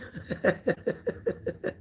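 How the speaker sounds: soft airy giggle; laugh. Real, not acted. Giggling after saying she is the wrong one. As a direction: giggle quietly at yourself.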